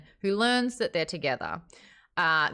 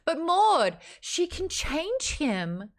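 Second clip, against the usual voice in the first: mock pleading; 9 semitones higher, much slower than usual. Mocking the romance excuse.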